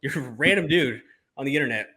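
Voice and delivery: high, loud and incredulous; exasperated outburst. Real, not acted. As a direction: fire it back, fed up. Exasperated retort.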